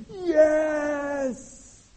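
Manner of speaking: high, loud triumphant yell; goal celebration. Retold or imitated. Preacher relives yesterday's goals with a loud cheer. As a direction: shout like four goals just went in.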